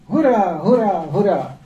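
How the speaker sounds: high, loud, slow cheer; crowd cheering. Retold or imitated. Another crowd cheering, loud drawn-out hurá. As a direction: call out hurá as the next crowd. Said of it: "Další dav lidí".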